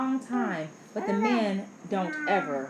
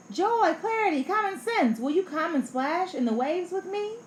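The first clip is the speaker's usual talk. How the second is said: high, pleading invitation; child asking; 8 semitones higher than usual. A narrator's reading. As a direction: call friends to play in a high voice. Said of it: "asks Anna Hibiscus".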